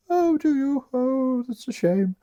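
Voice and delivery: high swooning lady's voice; Olivia voice. Acted. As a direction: coo it like a lovesick countess. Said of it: "I do this voice for Olivia".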